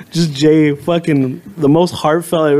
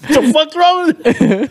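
laughing comeback; 9 semitones higher than usual. Retold or imitated. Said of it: "He's like".

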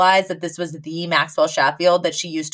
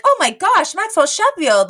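surprised gush; 10 semitones higher than usual. Retold or imitated. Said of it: "she's like".

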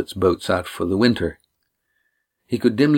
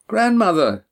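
surprised cry; 11 semitones higher than usual. A narrator's reading. Lindy startled at grandmother.

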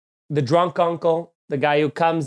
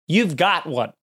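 usual speaking voice

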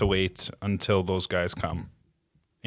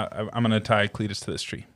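ordinary delivery

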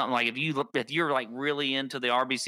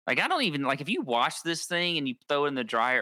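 usual speaking voice

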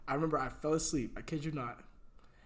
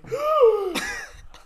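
imitated gasp; 7 dB louder than usual. Imitates girl gasping for air.

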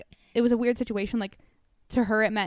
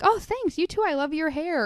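put-on bubbly girly voice; 6 semitones higher than usual. Imitating girl's bubbly reply.

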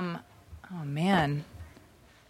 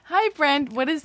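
high, chirpy lilt; cutesy reading-out voice; 8 semitones higher, slower than usual. Acted. Host resuming the girlfriend's note, high cutesy voice. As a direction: read it in a girly, high-pitched coo. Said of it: "why are you reading it like that".

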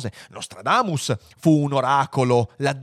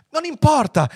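high, loud outburst; impatient exclamation; 6 dB louder than usual. A narrator's reading. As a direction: brush the warning aside urgently. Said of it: "esclamò Fuchs". (esclamazione acuta e impaziente)

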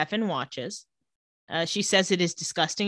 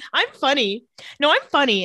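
mock insistence; 6 semitones higher, 5 dB louder than usual. Acted hysterical insistence in recap.

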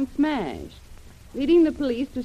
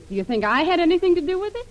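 higher and louder, outraged question; indignant outburst; 7 semitones higher, 5 dB louder, faster than usual. Acted. Lil rounding on Brent, higher and louder in outrage. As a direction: fling the accusation back at him. Lil accused of Jim's disappearance.

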